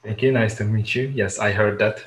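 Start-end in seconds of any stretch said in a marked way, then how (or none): none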